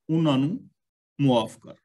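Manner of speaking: ordinary talk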